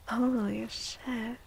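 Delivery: ordinary talk, nothing marked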